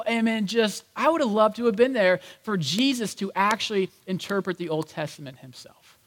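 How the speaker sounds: ordinary talk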